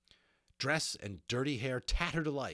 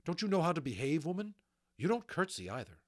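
quick, raised-pitch reprimand; scolding; 8 semitones higher, 5 dB louder, faster than usual. Acted. Magician lectures Molly on behaving before a unicorn, scolding. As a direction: tell her off briskly.

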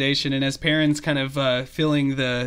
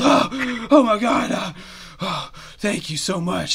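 higher, breath-catching relief that settles down; relieved treant; 6 semitones higher, slower than usual. Acted. Treant thanking his rescuers, overwhelmed relief. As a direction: gasp it out high, then ease into thanks.

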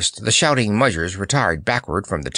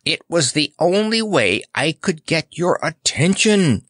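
ordinary delivery, nothing marked

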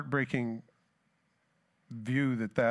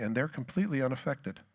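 ordinary talk

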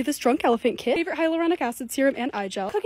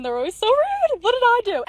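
higher, offended disbelief at the staff; indignant complaint; 9 semitones higher, 3 dB louder than usual. Real, not acted. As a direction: raise your voice in mock outrage. Complaining about rude store staff.

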